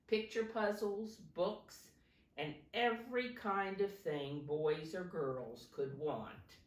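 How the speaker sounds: plain reading voice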